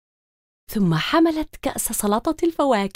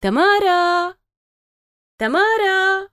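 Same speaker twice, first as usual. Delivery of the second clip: higher, raised call from another room; 7 semitones higher, slower than usual. A narrator's reading. Mother calls her daughter from the kitchen, calling. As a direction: call her name across the house. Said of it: "نادت أمها من المطبخ".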